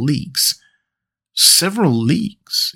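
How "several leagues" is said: a slow astonished rising question; exclaimed surprise. A narrator's reading. Conseil echoing the captain's claim, slow astonished question. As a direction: echo it back in slow disbelief. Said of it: "exclaimed Conseil".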